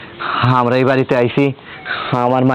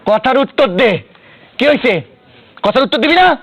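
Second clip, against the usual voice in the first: high, insistent interrogation; angry demand; 9 semitones higher than usual. Acted. Father demands to know where his daughter is, angry demand. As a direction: demand an answer, pitch climbing each time.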